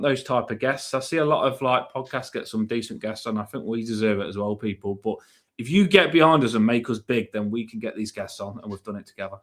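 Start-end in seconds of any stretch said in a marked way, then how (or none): none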